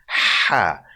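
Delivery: tight, breathy h in "Ha". Shown as a demonstration. Teacher demonstrates for learners, breathy tight ha. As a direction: say "Ha" with narrowed airways. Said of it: "it's the more breathy sound".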